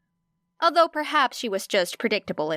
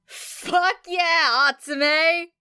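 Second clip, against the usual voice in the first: cup your hands and whoop it out; whooping cheer; 8 semitones higher, much slower than usual. A narrator's reading. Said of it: "whooping from the general studies section".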